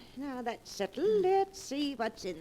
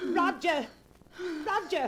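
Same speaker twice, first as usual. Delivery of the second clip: insistent high calls; calling out; 7 semitones higher than usual. Acted. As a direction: summon him with a raised voice. Calling nephew from upstairs.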